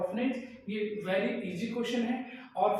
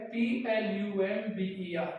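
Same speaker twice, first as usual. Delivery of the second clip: ordinary talk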